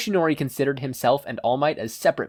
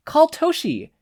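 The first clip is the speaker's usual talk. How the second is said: advice; 6 dB louder, slower than usual. Acted. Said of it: "Nana advised".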